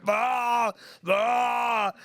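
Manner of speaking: loud, high retching noise, hammed up; mock retching. Tony retching in the rain at camp, mock heaving. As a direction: belt out a fake retch. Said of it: "Tony going".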